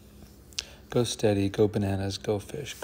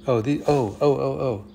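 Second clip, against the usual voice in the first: excited realization; 4 semitones higher, 6 dB louder than usual. Real, not acted. Sudden realization solving puzzle.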